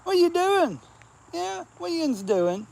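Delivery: high sing-song voice to the cats; cat-calling voice. Real, not acted. Man calling to stray cats in a high voice.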